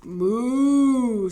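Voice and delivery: loud, long moo; cow moo. A narrator's reading. Hens mooing with the cow's sound. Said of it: "said the hens".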